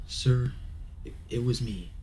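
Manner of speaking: earnest plea to an officer; anxious confession. Acted. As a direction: own up nervously, then insist. Jeff confesses to the cop.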